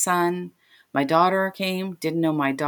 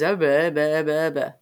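babbled nonsense syllables; mumbled stand-in for confused speech; imitated mumbling. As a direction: imitate garbled, meaningless speech. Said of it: "She's mumbling. I don't understand it".

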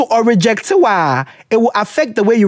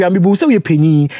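ordinary talk

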